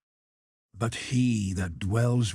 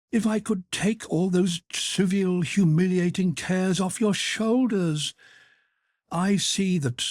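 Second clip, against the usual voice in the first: much higher, eager and pleading; the Countess pressing her help, lifted high; fervent offer; 10 semitones higher than usual. A narrator's reading. Countess eagerly offering to take his cares, much higher.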